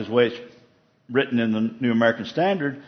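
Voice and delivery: ordinary talk, nothing marked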